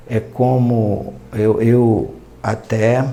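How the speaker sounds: usual speaking voice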